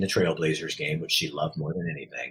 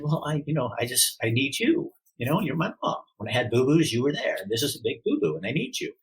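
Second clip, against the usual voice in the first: put-on higher-pitched, pleading like a hurt boy; retold tearful plea; 6 semitones higher than usual. Son telling his mom he needs her, retold tearful plea. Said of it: "I was crying".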